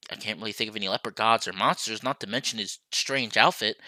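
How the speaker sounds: ordinary talk, nothing marked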